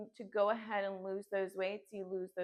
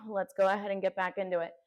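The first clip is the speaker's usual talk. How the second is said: usual speaking voice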